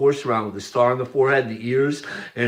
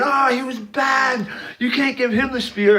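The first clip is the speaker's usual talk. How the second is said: mock villain voice; 12 semitones higher than usual. Hitler whining to the church about the speaker. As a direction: whine it like a cartoon villain.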